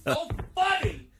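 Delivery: high, distant angry outburst; sarcastic rage from away off; very mad. Acted. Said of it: "He was mad".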